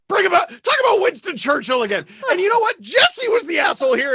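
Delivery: high-pitched, worked-up complaint; exasperated rant. Real, not acted. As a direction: rant back at teasing friends. Teased teller rants at co-hosts.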